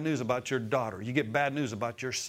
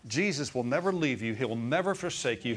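ordinary delivery

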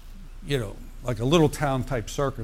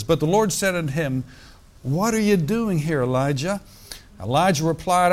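scripture reading; 3 semitones higher than usual. Acted. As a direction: lift the passage from the page like a preacher. Preacher reads scripture aloud.

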